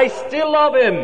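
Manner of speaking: fervent climax. Acted.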